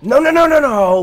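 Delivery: high, loud string of no's; panicked shout. Real, not acted. Gamer yelling as his character won't move, high panicked shout. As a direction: scream it as everything goes wrong.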